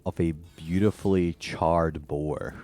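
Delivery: slow descriptive scene setting; game master narration. Acted. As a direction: paint the room slowly.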